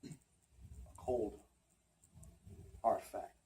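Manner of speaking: low, soft and halting; hushed, heartfelt stage delivery; subdued confession. Acted. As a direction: confess love reluctantly, with long pauses. Monologue turns to reluctant love confession.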